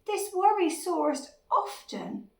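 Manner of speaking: lively, lilting picture-book read; children's story narration. A narrator's reading. Narrator reads a worried dinosaur's tale to children, storytelling. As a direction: read it to small children with big swoops of pitch.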